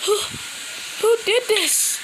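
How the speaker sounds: high, trembling cry of fear; shaky, frightened question; scared and shaky. Acted. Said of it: "In a scared and shaky voice".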